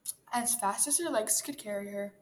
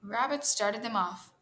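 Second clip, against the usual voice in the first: ordinary delivery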